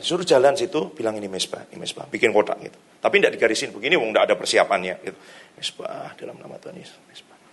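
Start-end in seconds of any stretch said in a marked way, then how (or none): 1.1-2.1 soft, low prayer murmur; murmured declaration. Retold or imitated. Menirukan deklarasi doa pelan. 5.5-7.4 hushed, near-whispered declaration; murmured prayer. Retold or imitated. Menirukan doa pelan saat berjalan.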